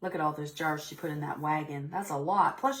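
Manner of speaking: usual speaking voice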